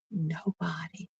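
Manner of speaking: ordinary delivery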